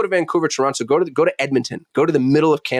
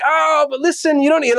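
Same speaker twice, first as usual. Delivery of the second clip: high, pitched-up haggling market seller; mock pushy seller; 12 semitones higher than usual. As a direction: playfully imitate a Cairo vendor who won't take no. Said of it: "they're like".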